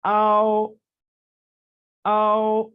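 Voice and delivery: teacher isolating the final आओ; Chinese final ao. Shown as a demonstration. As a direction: say आओ clearly on one held pitch. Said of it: "final है".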